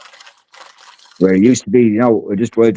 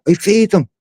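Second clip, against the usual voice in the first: imitating the woman; 13 semitones higher, slower than usual. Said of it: "she goes, uh".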